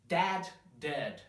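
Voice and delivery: "Dad" open a, then "dead" short eh; dad versus dead. Shown as a demonstration. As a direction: contrast "Dad" and "dead" clearly. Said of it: "This is the open sound".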